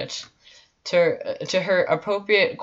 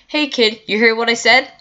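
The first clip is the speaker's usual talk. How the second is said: put-on raised, higher call to a kid; 7 semitones higher, 10 dB louder than usual. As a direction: call to the kid, loud and high. Calls out to crying toddler.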